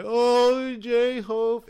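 high, loud, drawn-out mock wail; imitated tearful fan. Imitating the girl next to her starting to cry, mock wail. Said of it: "starting to cry".

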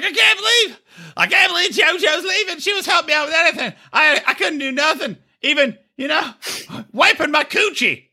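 hammed-up blubbering imitation of a friend; mock crying Angela. Friend retold crying over JoJo leaving.

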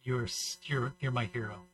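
softly, low and quiet, gushing over him; a quiet, awed little voice; mimicking a gushing lady in a near hush. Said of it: "Some lady came up to me and goes".

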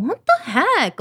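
high-pitched retold "what the heck"; exasperated disbelief. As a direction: act out baffled frustration, pitched right up. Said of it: "feeling all of this anxiety, all this resistance".

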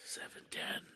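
hushed whisper. Real, not acted. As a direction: breathe it out softly. Whispered in awe.

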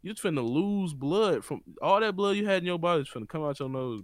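ordinary delivery

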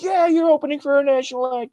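high, hyped fan cheer; excited fans. Retold or imitated. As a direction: imitate thrilled fans in a high voice. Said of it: "'cause our fans are like".

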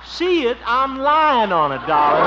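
high, loud, slow sarcastic yell; loud, high mock-sweet "darling"; exasperated shout. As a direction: call up, crushed under the sofa. Lying under sofa, exasperated.

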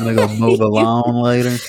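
mock hillbilly voice; country accent. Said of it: "Real country accent".

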